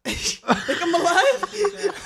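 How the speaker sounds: high, slow words squeezed through laughter; laughing disbelief. Retold or imitated. Telling friends about surviving trains, laughing high and slow. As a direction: laugh it out in shock at being alive.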